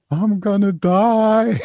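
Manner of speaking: high, slow wailing yell, hammed up; retold panic, drawn-out cry; mock drunken shout. Drunk bloke telling his angry girlfriend he's dying, high mock wail. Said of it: "So I started shouting out".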